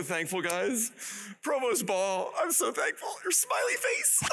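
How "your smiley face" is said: much higher, a delighted call-out; excited shout. Real, not acted. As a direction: point at them and cheer. Spots a smiling face in crowd.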